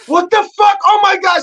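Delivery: high, loud mock outrage; imitating appalled wife. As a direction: play the wife bursting in, voice way up. Wife walks in, voiced by husband.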